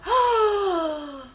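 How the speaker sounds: high surprised gasp; sharp gasp. Real, not acted. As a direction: gasp in amazement. Reacts to a fitting description.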